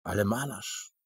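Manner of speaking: ordinary delivery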